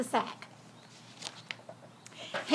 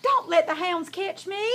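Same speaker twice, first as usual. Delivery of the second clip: raised, anxious plea; crying out for help; 5 semitones higher than usual. A narrator's reading. Fox begs the pig to save him from hounds, crying out. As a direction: plead in mounting alarm. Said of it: "cried the fox".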